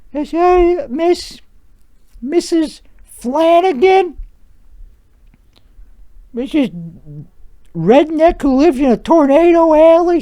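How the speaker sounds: very high, slow, sugary phone voice; sweet talk voice. Acted. Adjuster calling a homeowner to lowball them, sugary sweet phone voice. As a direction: coo it like you're charming an old lady on the line. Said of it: "in the sweet talk voice".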